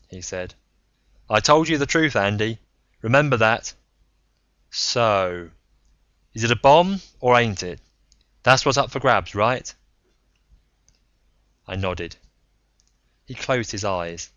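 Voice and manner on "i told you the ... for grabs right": defiant. Acted. Braun pressed, defiant.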